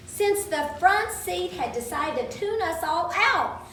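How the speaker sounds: usual speaking voice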